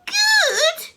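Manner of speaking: high, loud rising scream; loud incredulous shriek. A narrator's reading. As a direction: scream the word back. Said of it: "she screamed".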